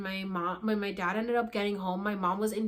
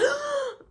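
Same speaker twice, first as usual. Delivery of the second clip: high, loud gasp for air; waking from sleep, gasping for breath; desperate gasp for air; 4 dB louder than usual. Retold or imitated. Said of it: "I'm like".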